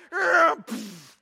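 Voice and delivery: mock brush-off noise; dismissive groan. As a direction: scoff it off loudly. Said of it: "we're like".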